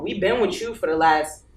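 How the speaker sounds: normal talking voice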